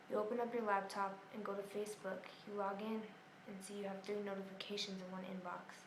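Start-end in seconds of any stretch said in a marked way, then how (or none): none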